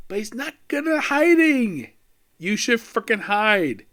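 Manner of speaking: high-pitched, incredulous scolding; exasperated. Real, not acted. Reviewer scolding a ninja who is terrible at hiding, exasperated.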